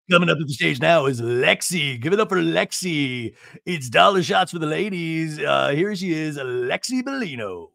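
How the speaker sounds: lightly voiced emcee hype; mock club announcer. Host mocking Lexi as a stripper, emcee voice. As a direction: announce her like a club act.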